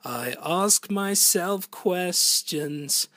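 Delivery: fuller, slow, pushed-out take; strong full singing voice. Shown as a demonstration. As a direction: give it full voice, slowly. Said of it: "it's either that or".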